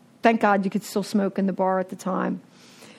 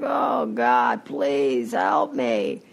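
desperate plea; 10 semitones lower, much slower than usual. Retold or imitated.

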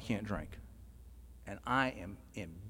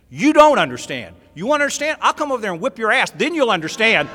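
loud, high threatening yell; roaring threat across the meeting room; furious shout; 9 semitones higher, 14 dB louder than usual. Retold or imitated. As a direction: blow up at the man who said he understood. Furious outburst standing up in meeting.

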